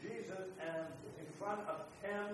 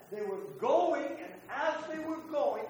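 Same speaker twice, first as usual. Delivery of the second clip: louder, drawn-out rising fervour; excited build; 7 semitones higher, 9 dB louder, slower than usual. Real, not acted. Preacher builds to the miracle for his listeners, louder and stretched out. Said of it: "preacher's excited build".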